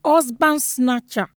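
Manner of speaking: high, loud, furious name-calling; angry woman shouting abuse; yelled insults. A narrator's reading. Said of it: "she yelled".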